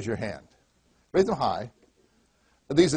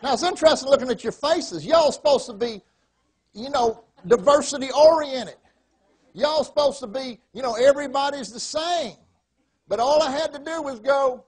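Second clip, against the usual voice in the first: high, loud put-on Texas twang; animated, imitated Texan speech; Texas accent; 9 semitones higher, 5 dB louder than usual. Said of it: "You don't like my Texas accent".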